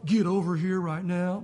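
low, slow playful command; hammed-up stern summons; mock stern parent. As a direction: pretend to order the kids over, drawn out.

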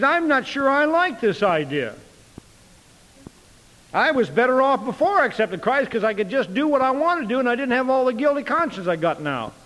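high-pitched grumbling of an imitated new believer; acted complaint. Young convert regretting her guilty conscience, mock grumbling.

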